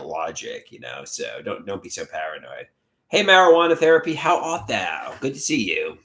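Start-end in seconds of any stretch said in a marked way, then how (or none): 3.1-5.1 loud, playful greeting to Marijuana Therapy in the chat. Pretend. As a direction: call out the name, then stretch the question. Greeting a viewer.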